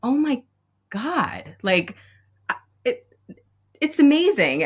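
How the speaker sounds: overwhelmed awe. Real, not acted. Teacher overwhelmed recalling the letter, high and breathless. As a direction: let the words catch in your throat.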